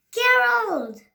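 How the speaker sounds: high loud call of the name. Acted. Piggy calling her friend, loud high call. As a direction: shout the name across to a friend.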